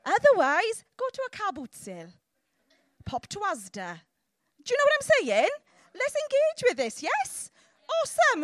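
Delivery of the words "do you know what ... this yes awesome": high, loud, pumped-up calls; rallying the crowd. Real, not acted. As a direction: whip up the congregation. Preacher rallies the crowd.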